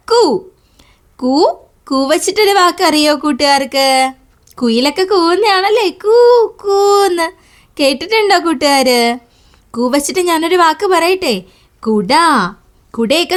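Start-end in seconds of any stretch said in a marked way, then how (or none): 5.9-7.3 cuckoo call. Retold or imitated. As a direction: call out the bird's coo for the kids. Teacher imitates cuckoo call. (കുയിലിന്റെ കൂവൽ അനുകരിച്ച്)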